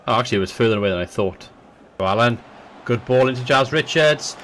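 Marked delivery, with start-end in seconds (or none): 2.0-4.4 football commentator calling the match; live match commentary, building with the chances; play-by-play commentary. Acted.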